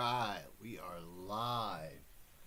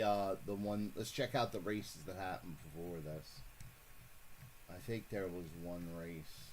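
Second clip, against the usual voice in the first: ordinary talk, nothing marked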